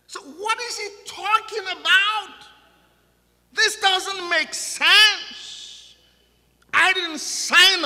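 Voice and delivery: high, loud, slow, baffled disciple voice; grumbling disciples. Acted. Disciple confused by Jesus, loud high grumbling. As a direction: play a disciple who wants out.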